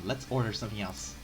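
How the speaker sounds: ordinary talk, nothing marked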